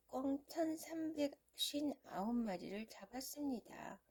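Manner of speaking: plain reading voice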